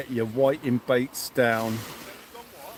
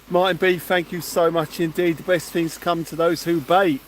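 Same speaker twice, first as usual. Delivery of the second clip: usual speaking voice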